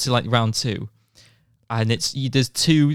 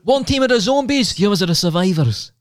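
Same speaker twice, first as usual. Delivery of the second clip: higher, louder keen explanation; excited telling; 8 semitones higher, 3 dB louder than usual. Real, not acted. Friend eagerly explains the zombie paintball teams. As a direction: sell the rules with excitement. Announcing zombie game rules.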